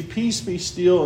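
slow, measured calm command; Jesus stills the sea with a slow, calm command. Acted.

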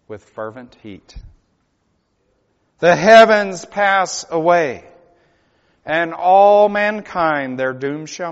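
slow, loud, chanted hymn lines; slow solemn hymn recital; hymn recited. Acted. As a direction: intone the hymn like a warning. Recites hymn lyrics slowly.

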